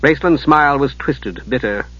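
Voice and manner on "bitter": plain reading voice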